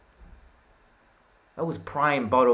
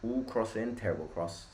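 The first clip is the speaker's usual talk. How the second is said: ordinary talk, nothing marked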